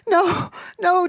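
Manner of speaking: high, distressed cry of denial; child crying out. A narrator's reading. Dorothy grabs her mama's skirt, crying out. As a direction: cry out in dismay, pitched high. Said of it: "Dorothy cried".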